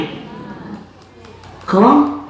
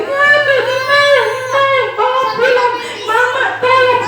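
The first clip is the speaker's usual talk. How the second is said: very high wailing plea; crying for mama; 13 semitones higher than usual. Acted. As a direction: cry out desperately for home. Tied child cries for parents.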